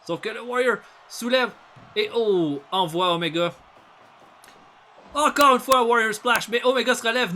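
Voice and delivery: rising play-by-play that erupts into shouts; wrestling commentator. Acted. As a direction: call the finisher as if you can't believe it. Live wrestling play-by-play, peaks.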